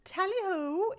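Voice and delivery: chirpy fake British sign-off; mock British. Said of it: "I don't know why I became British".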